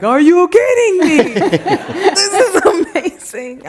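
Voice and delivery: very high, loud, laughing amazement; thrilled disbelief. Retold or imitated. Poet reacting to a young girl's video, thrilled disbelief. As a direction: squeal it out, overjoyed and incredulous.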